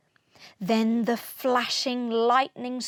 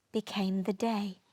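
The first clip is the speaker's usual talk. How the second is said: plain reading voice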